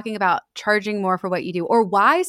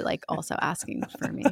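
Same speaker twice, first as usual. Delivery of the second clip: whispered aside; 3 semitones lower, 9 dB quieter than usual. Real, not acted. Confessing aside after plea.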